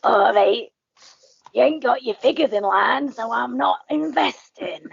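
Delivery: a rough, common voice, slowing at the end; a brash businesswoman, lightly voiced; rough Dragon impression. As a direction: mimic a blunt investor brushing someone off. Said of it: "She'd go".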